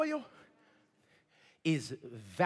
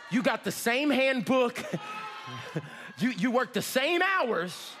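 forceful preaching; 3 semitones higher than usual. Real, not acted. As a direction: fire questions at the congregation, building up. Challenges the congregation forcefully.

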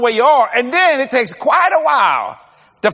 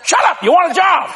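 sudden high, sharp mock shout; mock snap; 7 semitones higher than usual. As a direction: cut him off, half laughing. Snapping at a job-seeker.

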